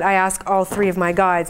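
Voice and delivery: ordinary talk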